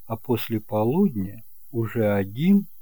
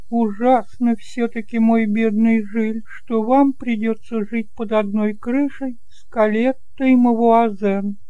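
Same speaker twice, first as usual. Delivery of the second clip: put-on higher and louder, a fussing elderly aunt; chatty kind aunt; 11 semitones higher, 5 dB louder than usual. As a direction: play the doting aunt, bright and eager to please. Aunt warns Gilles.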